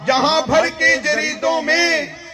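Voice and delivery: measured, projected mushaira recitation; poetry recital. Acted. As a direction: declaim the verse to the crowd, stretching the line ends. Recites Urdu poetry to audience.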